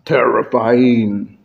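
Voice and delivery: low, slow, ominous word; terrifying. Shown as a demonstration. Speaker demonstrating a range of emotions, slow and menacing. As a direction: stretch it out low to frighten. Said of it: "you can have a range of emotions".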